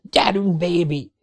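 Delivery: urging the helicopter desperately; frantic yell. A narrator's reading. Keris frantically urges the falling helicopter. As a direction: beg the machine to hold. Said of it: "üvöltötte Keris magán kívül".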